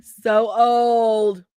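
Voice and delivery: loud, drawn-out wail; comic lament. Real, not acted. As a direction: cry it out long and loud in mock despair. Bemoans needing bigger font.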